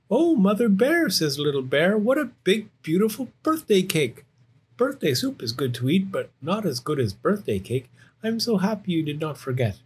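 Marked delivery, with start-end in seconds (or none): 0.1-1.1 high, loud happy amazement; delighted surprise. Acted. Little Bear seeing Mother Bear's cake, high delighted surprise. 2.0-9.8 high bear cub, thrilled then settling; delighted Little Bear. Acted. Little Bear thanking Mother Bear for cake, high and delighted.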